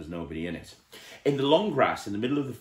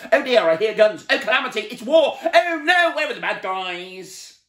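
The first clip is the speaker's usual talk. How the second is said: high, loud panicked wailing; panicking; 13 semitones higher, 9 dB louder than usual. A narrator's reading. Mister Jelly hiding under the kitchen table, loud panicked wail. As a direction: wail that war has come.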